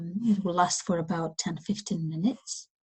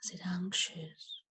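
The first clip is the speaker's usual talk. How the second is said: unhurried, softening as the questions go on; guided meditation; 2 semitones higher than usual. Acted.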